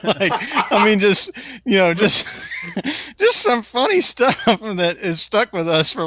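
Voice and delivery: high-pitched, talking through laughter; laughing while talking. Real, not acted. Storyteller cracking up at his own story, laughing speech. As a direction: try to explain while cracking up.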